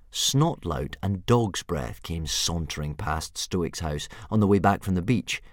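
ordinary delivery, nothing marked

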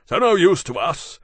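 loud, high, indignant grumble; Berkeley, gloomy. A narrator's reading. Berkeley complaining to Lawrence, loudly gloomy. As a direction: curse the bad news, pitch climbing. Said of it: "Berkeley said to Lawrence gloomily".

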